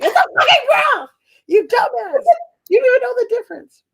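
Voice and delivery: outraged, high-pitched scolding; exasperated outburst. Acted. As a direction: yell it at a clueless date, voice way up. Acted outburst at clueless date.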